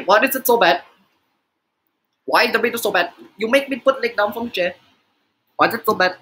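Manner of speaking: exaggerated mock Indian accent; clumsy, put-on Indian accent; bad Indian accent. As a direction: scold in a crude Indian accent. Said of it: "my only idea of what an Indian accent is".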